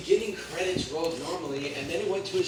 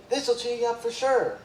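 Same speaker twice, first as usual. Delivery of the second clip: cheerful Sandy voice; 8 semitones higher, 4 dB louder than usual. A narrator's reading. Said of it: "She gave SpongeBob a Krabby Patty".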